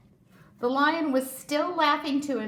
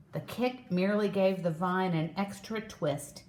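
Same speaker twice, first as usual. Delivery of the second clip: usual speaking voice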